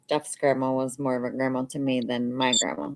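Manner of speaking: usual speaking voice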